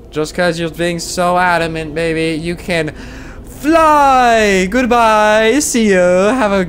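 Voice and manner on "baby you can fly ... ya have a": teasing send-off. Real, not acted. Sending a creature flying, teasing.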